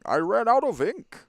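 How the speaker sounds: high, loud pinched nasal whine. Acted. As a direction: whine it through the nose. Nasal shopkeeper voice again.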